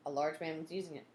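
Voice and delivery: ordinary delivery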